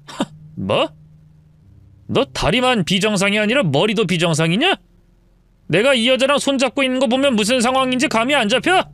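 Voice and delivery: scornful cheating husband, mocking his wife; sneering husband. Husband caught cheating mocks his wife, scornful. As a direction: sneer down at her like she's stupid.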